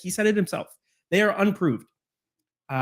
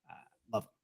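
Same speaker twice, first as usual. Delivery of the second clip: whispered; 15 dB quieter, much faster than usual. Real, not acted. Affectionate aside about his GM.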